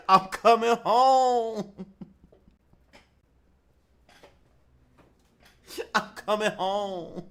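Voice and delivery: slow, loud, drawn-out hammed-up lament; mock dying prayer. Son mock praying in the woods, slow hammed-up wail.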